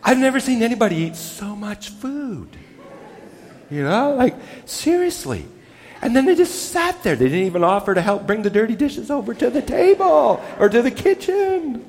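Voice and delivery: mock complaining. Mock host complaining about guests.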